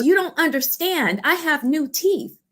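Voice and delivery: high, earnest imitation; lightly acted insistence; insistent joy. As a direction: make them understand her happiness. Lady insists on her joy.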